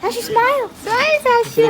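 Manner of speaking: high sing-song coaxing. Real, not acted. Mother coaxing her toddler for a photo, high coaxing.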